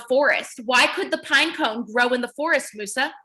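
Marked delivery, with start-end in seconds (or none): none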